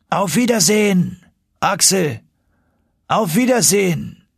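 calling through tunnels. Acted. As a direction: voice the uncle shouting across the rock. Uncle's farewell call.

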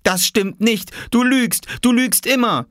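high, heated accusation; angry child's protest. Acted. Oskar accusing Jule of lying, angry protest. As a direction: fire back like a furious small boy. Oskar quarrels with his sister.

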